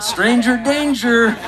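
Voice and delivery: slow, hammed-up stranger danger; drawn out like a wary parent; mock scared. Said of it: "looking a little bit scared".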